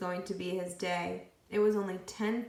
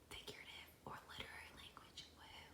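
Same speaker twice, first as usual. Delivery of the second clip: whisper; 5 semitones higher, 23 dB quieter, faster than usual. Acted. Aside whispered during reading.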